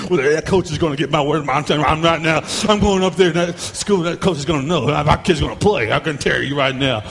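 mock angry mom; protective parent. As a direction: play the indignant parent.

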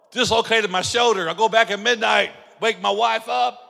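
ordinary talk, nothing marked

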